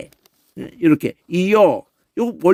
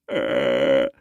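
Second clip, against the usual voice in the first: throat rattle demo; 3 dB quieter than usual. Shown as a demonstration. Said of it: "이렇게".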